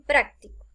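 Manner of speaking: práctico with accent on PRÁC; stress on first syllable. Shown as a demonstration. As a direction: say PRÁC-ti-co, weight up front. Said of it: "la posición de la tilde".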